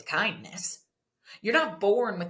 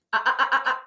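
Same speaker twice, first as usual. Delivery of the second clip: scolding uh-uh; 7 semitones higher, 9 dB louder, much faster than usual. Retold or imitated. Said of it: "so if I were to say then".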